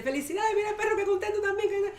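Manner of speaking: high, loud, gleeful party guests; mock crowd cheer. Party guests cheering the seemingly happy dog, mock-excited. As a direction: imitate a delighted crowd, voice climbing very high.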